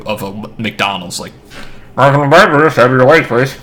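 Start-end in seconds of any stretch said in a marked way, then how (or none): 2.0-3.5 very slow, louder, higher speaker-box voice; drive-thru speaker. Pretend. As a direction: drawl it through a drive-thru intercom.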